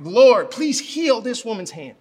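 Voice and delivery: loud, drawn-out plea to God; big pleading prayer. Retold or imitated. Modeling prayer over a woman's hand, loud grand plea. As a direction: pray it big and slow, imploring. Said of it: "all the big words. I'm like".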